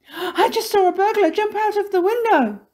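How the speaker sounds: high, loud panicked report; alarmed. A narrator's reading. Missus Plum who just saw a burglar, high and panicked. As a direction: blurt it out in shock, high-pitched.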